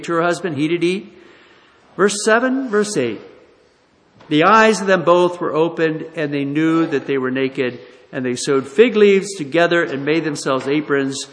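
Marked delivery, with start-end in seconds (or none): 4.3-11.3 loud, declaiming Bible reading; scripture reading. Acted. Preacher reading Genesis to congregation, loud declaiming. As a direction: proclaim the verses to the church.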